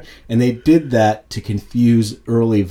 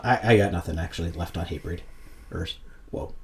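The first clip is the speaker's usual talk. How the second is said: ordinary talk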